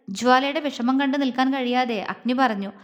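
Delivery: usual speaking voice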